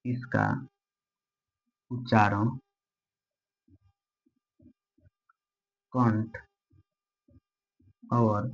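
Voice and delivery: slow dictation with long pauses between words. Acted. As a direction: read each word out so students can write it. Teacher dictates notes slowly. (धीमे, रुक-रुक कर लिखवाना)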